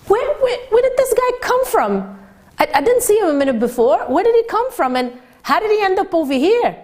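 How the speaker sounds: high, baffled exclamation; wondering where he came from, pitched high; astonished. Retold or imitated. Narrator recalling the tzaddik appearing, high and amazed. Astonished inner thought about Mendel.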